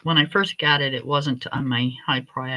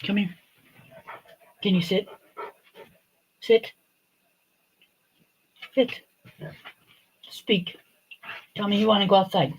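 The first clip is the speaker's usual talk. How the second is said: higher, coaxing dog-owner voice; talking to the dog; 4 semitones higher than usual. Real, not acted. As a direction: call the dog over, sweet but firm. Calling and commanding the dog.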